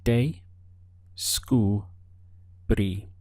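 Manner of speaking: de, scu, bri said one syllable at a time; split into syllables. Shown as a demonstration. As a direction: break descubrí into de, scu, bri with pauses. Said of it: "Counting the syllables".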